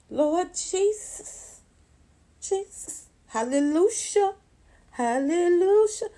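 high, slow drawn-out praise; church praise. Retold or imitated. Thanking the Lord after outwitting mom, high slow praise. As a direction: sing out Lord Jesus like in church. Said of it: "I'm like".

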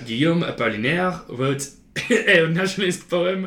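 put-on showing off a French accent while giggling. Host slipping back into a French accent for the poem. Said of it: "I didn't mean to continue speaking that accent".

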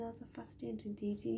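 quick whispered side note; whispered aside. Real, not acted. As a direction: rush the aside under your breath. Quick aside about the year.

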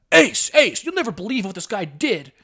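high, urgent calling out; mock outraged tattling, starting loud; imitated indignant parent. Parent reporting boy to Ace, mock indignant.

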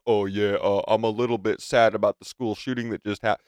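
a hint of a mumbling, dopey president; lightly mock a flat, scripted apology; mocking Biden. Podcaster mocks the president's scripted sad statement, dopey mock voice. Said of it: "program him to be like".

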